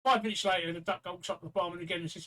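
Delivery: usual speaking voice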